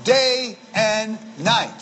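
slow, deliberate, drawn-out words; nice and slow. Shown as a demonstration. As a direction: let each word sit. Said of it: "nice and slow. See, that's the way to do it".

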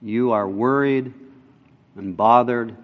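soft, low and gentle words of correction; Jesus gently telling Martha of her worry, tender; tender rebuke. Retold or imitated. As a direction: correct her softly and kindly. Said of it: "kindness and tenderness ... the voice".

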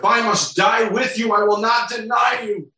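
high-pitched, insistent vow; emphatic. Acted. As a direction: swear it with emotional force. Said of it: "He said emphatically".

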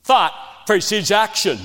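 "Thought" hit hard on a loud second take; emphatic repeat. Shown as a demonstration. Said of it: "You hear me?".

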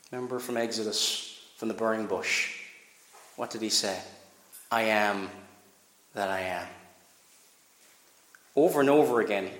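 low, soft, reverent hush; hushed preaching. Acted. Preacher recalls the burning bush to listeners, hushed and reverent. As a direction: draw them close and quiet.

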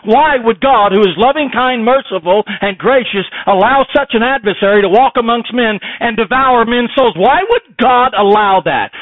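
loud, high, driving sermon climax; preacher pounding the question home to the congregation, slowing on the last line; preaching at full force. Acted. Preacher to congregation, loud impassioned preaching.

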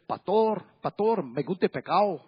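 Colombian coast Spanish, S dropped in "Pastor" and "pescado"; coastal Colombian accent. Retold or imitated. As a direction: swallow every S like a woman from the Colombian coast. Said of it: "They don't pronounce the S".

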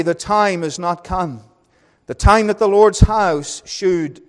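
high, loud preacher's reading, slowing on key phrases; solemn King James reading voice building in force; fervent scripture reading. Acted. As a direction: proclaim the text from the pulpit like the word of God itself. Reads scripture aloud, preaching.

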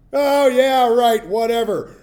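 high, loud sneering brush-off; scoffing teenager; dismissive teen. Acted. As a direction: roll your eyes and wave him away. Youths brushing off Elisha's warning.

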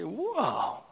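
soft, high drawn-out wow; amazed. Retold or imitated. Stranger handed a million-dollar tract, softly amazed. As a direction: breathe a hushed, imitated awe. Acting out amazed recipient of bill.

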